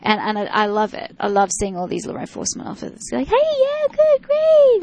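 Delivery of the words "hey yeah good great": very high, excited praise voice; cheerleader voice. Retold or imitated. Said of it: "find their inner cheerleader voice".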